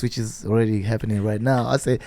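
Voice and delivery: ordinary delivery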